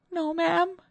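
small high-pitched reply; meek, frightened maid. A narrator's reading. Frightened maid answers her mistress, meek and high.

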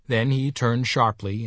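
plain reading voice

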